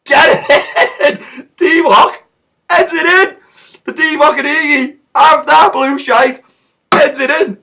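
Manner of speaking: high, very loud celebratory shrieks; ecstatic goal screaming. Retold or imitated. Fan celebrating Origi's header, screaming with joy. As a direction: scream it out slowly, bursting with joy.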